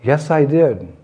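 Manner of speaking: firm, slightly lifted "Yes, I did"; insisting. Acted. Krishna repeating his confession to mother, insistent. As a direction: stand your ground, voice up a touch. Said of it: "And once again".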